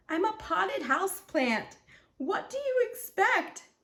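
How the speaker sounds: high, exasperated refusal; dismissive. A narrator's reading. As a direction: brush him off, rising high. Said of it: "Fern would soundly reject".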